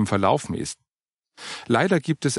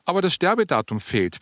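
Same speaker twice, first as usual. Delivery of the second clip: higher voice with a grin in it; mildly amused, pitched up; grinning remark; 8 semitones higher than usual. A narrator's reading. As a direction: point it out with a light, teasing grin. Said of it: "bemerkte Oliver grinsend".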